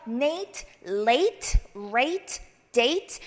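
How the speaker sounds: slow drill of "Nate", "late", "rate", "date", endings stopped; cleanly cut-off endings. Shown as a demonstration. Teacher showing how -ate endings are cut off, cleanly separated. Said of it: "이렇게 끊어주시고요".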